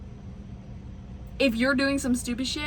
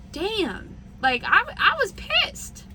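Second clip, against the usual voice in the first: worked-up high voice; 8 semitones higher, slower than usual. Real, not acted. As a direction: voice climbing high and slow, really riled. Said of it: "my voice is getting all high".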